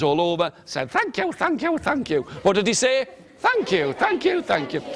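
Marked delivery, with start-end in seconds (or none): 0.9-2.2 high, small squeaky thanks; quick high-pitched little voice; tiny magic man. Acted. 3.4-4.8 high little voice; small, high-pitched repeated thanks; tiny magic man. Acted.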